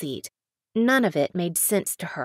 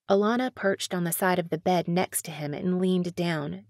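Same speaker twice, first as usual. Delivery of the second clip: ordinary delivery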